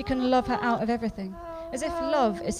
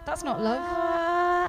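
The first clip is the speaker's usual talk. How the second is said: slow emphatic; 6 semitones higher, much slower than usual. Acted. Repeats her line slowly.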